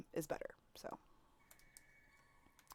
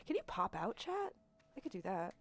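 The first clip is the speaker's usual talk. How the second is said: usual speaking voice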